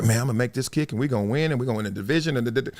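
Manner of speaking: fast, low, hushed rattle; retold racing thoughts. Kicker jogging on, thinking ahead, hurried mumbling. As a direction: run through it softly under pressure.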